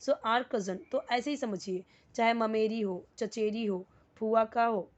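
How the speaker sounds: ordinary talk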